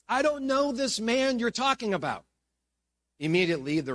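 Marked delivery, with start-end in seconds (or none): 0.1-2.3 high-pitched, vehement disowning; sworn denial. Acted. Peter swears to the bystanders he knows no Jesus, vehement. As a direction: deny it hard, as if swearing an oath. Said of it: "began to call down curses".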